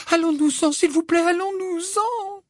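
very high, pleading voice, stretched at the end; frightened high-pitched plea, trailing off; terrified Hermione. A narrator's reading. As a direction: beg to leave in shrill fright. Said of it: "d'une voix aiguë et terrifiée".

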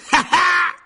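high, loud mocking laugh; boisterous ghost cackle; crying out. A narrator's reading. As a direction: shriek a derisive laugh. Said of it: "s'écria la tête de Sir Patrick".

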